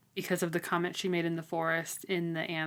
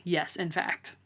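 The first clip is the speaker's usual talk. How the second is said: normal talking voice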